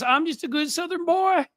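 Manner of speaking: mocking fake Southern accent, pitched very high; John Kennedy. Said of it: "he puts on the fake accent".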